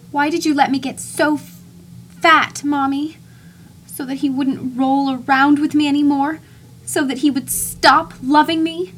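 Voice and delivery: high, loud, wounded plea to Mommy; pleading son. Acted. Grown son begs his dead mother, high and hurt. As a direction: beg her like a hurt child.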